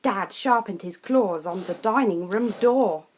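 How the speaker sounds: usual speaking voice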